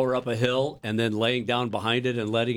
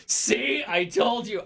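imitating wife; 8 semitones higher, slower than usual. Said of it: "And my wife goes".

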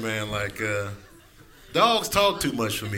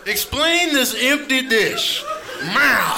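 yelling hungry cat; 7 semitones higher, much slower than usual. Acted. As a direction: demand food like an outraged cat. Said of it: "cats are yelling at you when they're hungry".

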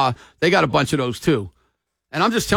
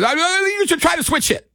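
put-on Cuban accent; 10 semitones higher than usual. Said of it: "this Cuban accent".

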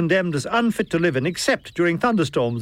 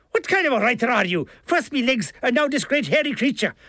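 high, indignant Irish complaint; aggrieved Milligan; 7 semitones higher than usual. A narrator's reading. Milligan berates the author over legs and wife, aggrieved and high. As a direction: protest in a raised character voice.

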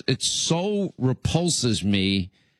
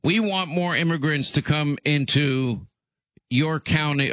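ordinary talk, nothing marked

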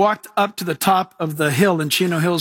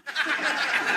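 breathy laugh; 8 semitones higher, 8 dB quieter than usual. Real, not acted. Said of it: "It was hilarious".

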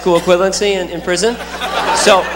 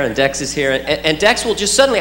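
ordinary delivery

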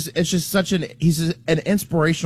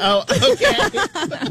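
drawn-out, laughing okay; much slower than usual. Real, not acted. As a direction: laugh through the words. Laughing reaction to the joke.

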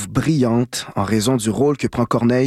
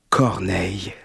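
savoring the name; 6 semitones lower, 4 dB quieter, slower than usual. Acted. Radio host swooning over his childhood idol, soft and savoring. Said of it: "Je pourrais prononcer son nom pendant des heures".

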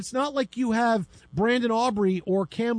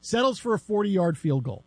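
ordinary delivery

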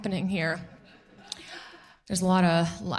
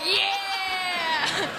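crowd cheer; 9 semitones higher than usual. Retold or imitated. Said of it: "everybody's like".

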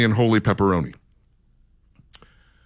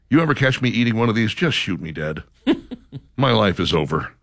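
usual speaking voice

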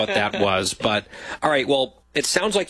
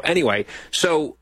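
ordinary delivery

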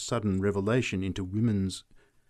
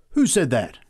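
higher, louder challenge; sudden raised-voice demand; startled cry; 4 semitones higher, 6 dB louder than usual. A narrator's reading. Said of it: "he cried".

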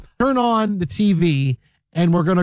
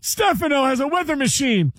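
much higher, put-on gushing fan voice; mock soap fan; 7 semitones higher than usual. Teasing the cohost about soaps, high mock fan voice.

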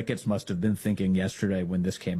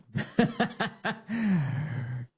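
real laugh; 8 semitones higher than usual. Real, not acted. Laughing after the clip.

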